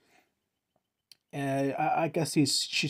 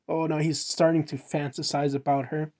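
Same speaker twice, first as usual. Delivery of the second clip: ordinary talk, nothing marked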